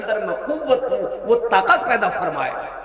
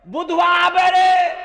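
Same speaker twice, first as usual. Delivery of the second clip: calling out; 8 semitones higher, slower than usual. Retold or imitated. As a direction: call across the house for help. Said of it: "भाई को आवाज दोगे".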